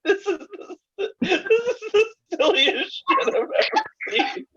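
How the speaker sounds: high, breaking laughter; laughing. Real, not acted. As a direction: laugh helplessly through it. Laughing at the silly picture.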